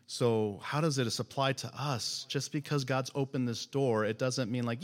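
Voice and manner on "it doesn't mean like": ordinary delivery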